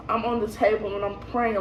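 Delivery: ordinary delivery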